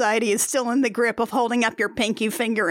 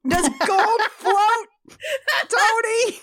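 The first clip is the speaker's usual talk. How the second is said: laughing, high-pitched and drawn-out; mock exasperated outcry; 10 semitones higher, much slower than usual. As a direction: cry it out in mock disbelief through laughter. Mock exasperation at Tony's logic, laughing.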